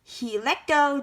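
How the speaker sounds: ordinary delivery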